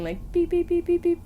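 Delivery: high beeping imitation of a ghost detector; mock machine beeping. Telling friends how the ghost machine beeped, high mock beeps. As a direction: beep it high like the device going off.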